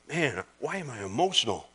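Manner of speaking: fighting back real tears mid-sermon; a real catch of emotion in the voice; emotional. Real, not acted. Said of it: "starts weeping before you".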